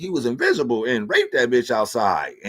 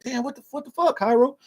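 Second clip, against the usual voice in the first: startled, much higher cry of disbelief; shocked outburst; 14 semitones higher than usual. Retold or imitated. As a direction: yelp it out in sudden alarm at your son. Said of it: "I'm like".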